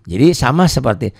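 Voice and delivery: ordinary talk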